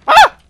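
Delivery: short, high, loud shriek; startled yelp. Real, not acted. Player opening a door and startled, shrieking. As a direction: yelp in sudden shock.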